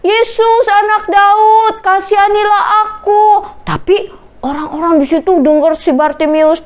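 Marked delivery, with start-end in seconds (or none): 0.0-3.2 acted beggar's shout, pitched up; pleading shout. A narrator's reading. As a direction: call out to someone far off, stretching the words. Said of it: "dia teriak lagi".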